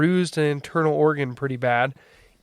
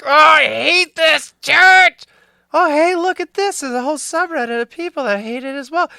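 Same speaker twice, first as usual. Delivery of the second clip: freshly frustrated; 9 semitones higher, 5 dB louder than usual. Retold or imitated. Ex-member ranting to the subreddit about church, mock frustrated. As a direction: imitate a fed-up newcomer venting. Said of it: "like, freshly frustrated".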